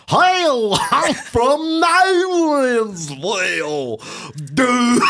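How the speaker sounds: high, drawn-out mock Southern twang; Nolensville Southern accent. Teasing girlfriend about her hometown, exaggerated Southern accent. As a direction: stretch every vowel in an over-the-top Southern accent. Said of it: "This is the accent that I do".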